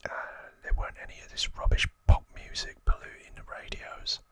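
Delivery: lightly acted Cockney gangster, whispering; Cockney accent. Gangster reminiscing about childhood with Archie, whispered Cockney accent. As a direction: whisper a put-on Cockney grumble. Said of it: "That's my best Cockney accent".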